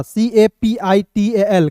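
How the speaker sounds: slow, each letter set apart; teacher spelling capital for the class. Shown as a demonstration. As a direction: spell C-A-P-I-T-A-L carefully. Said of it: "capital letter".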